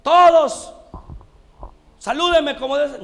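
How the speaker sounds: high, booming, drawn-out announcement; hammed-up military shout, easing slightly at the end; mock shouting general. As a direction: bellow like a general demanding salutes. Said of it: "no anda gritando".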